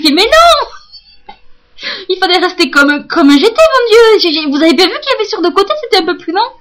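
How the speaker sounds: high, loud, fast pleading; loud panicked complaint to the hairdresser; distressed protest. Retold or imitated. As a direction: protest in panic, rushing the words. Quoting her own protest.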